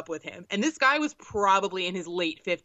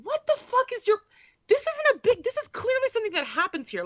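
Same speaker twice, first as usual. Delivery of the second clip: much higher, disbelieving; incredulous; 12 semitones higher than usual. Retold or imitated. Daughter scolding her scared mom on the street, incredulous. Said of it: "I'm just staring at her like".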